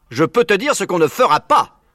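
loud, high snapping reply; furious farmer cutting in; angry retort. Acted. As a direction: bite back hard. Bean answering in anger.

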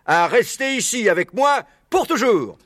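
high proclamation of "pour toujours"; inviting fox orator. Acted. Maître Renard inviting the animals to stay, proclaiming. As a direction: announce "pour toujours" as one grand speaker.

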